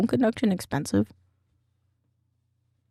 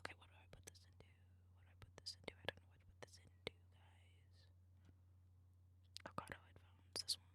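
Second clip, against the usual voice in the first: whispering; 30 dB quieter, faster than usual. Real, not acted. Lost streamer whispering to himself.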